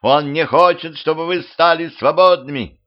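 high, loud rabble-rousing; squealed appeal. A narrator's reading. Ruler turns the crowd against her rival, high shrieking appeal. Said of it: "завизжала повелительница".

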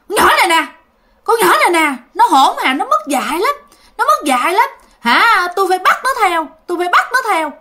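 a hint of a vengeful ghost scolding; possessed girl's angry voice. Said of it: "cái vong đó mới trả lời".

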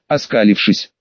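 ordinary delivery, nothing marked

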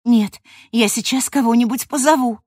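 insist, getting help now; urgent. Acted.

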